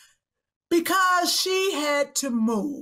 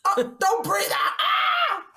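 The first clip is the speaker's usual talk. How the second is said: high, squealed mock alarm; mock panic; 8 semitones higher, slower than usual. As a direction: yelp in joking panic. Joking panic over shared breath.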